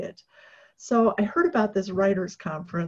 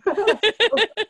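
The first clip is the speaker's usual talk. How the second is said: high, loud laugh of disbelief; delighted laugh; 14 semitones higher, 7 dB louder than usual. Real, not acted. Speaker recalling the agent confirming the offer, loud laugh. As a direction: burst out laughing.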